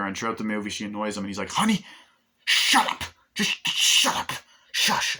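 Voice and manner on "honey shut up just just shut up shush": mock yell. Detective yells at nagging wife.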